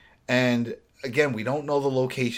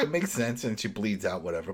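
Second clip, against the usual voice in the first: normal talking voice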